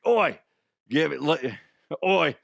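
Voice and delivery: mock Cockney with a punched "Oi"; put-on Cockney accent. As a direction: ham up a Cockney come-on. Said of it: "But Cockney".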